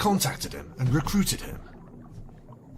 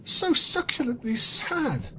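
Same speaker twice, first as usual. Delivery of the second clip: much higher, louder and slow mock lament; hammed-up sadness; 11 semitones higher, 5 dB louder, slower than usual. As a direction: savour the line in overdone sorrow. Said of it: "She sadly licked".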